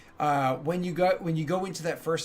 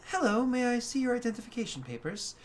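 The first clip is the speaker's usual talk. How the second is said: polite clerk; 10 semitones higher than usual. Acted.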